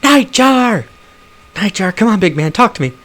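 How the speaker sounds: high, loud scream of a name. Acted. Orc girl calling to her shot friend, screaming high. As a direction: cry out to a fallen friend in panic. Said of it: "screams from cover".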